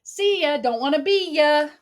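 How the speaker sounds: put-on high, loud, cheeky toddler taunt; a lightly voiced little girl waving goodbye; cheeky little kid. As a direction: tease like a runaway toddler. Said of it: "Willow would be like".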